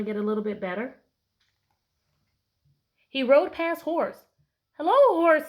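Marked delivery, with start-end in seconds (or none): 4.8-5.5 cheerful duck greeting. Acted. Duck greets horse in story.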